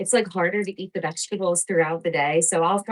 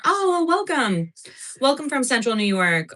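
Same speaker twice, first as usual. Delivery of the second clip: excited greeting; 7 semitones higher, 3 dB louder than usual. Real, not acted. Greeting a viewer on live.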